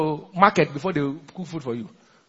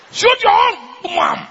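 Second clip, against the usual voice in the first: high, slow, laughing shout; hammed-up jeer, high and dragged out; mock taunt; 14 semitones higher, 6 dB louder, much slower than usual. Mocking a coward's fake bravado.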